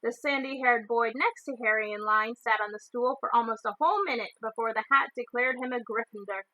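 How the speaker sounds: ordinary delivery, nothing marked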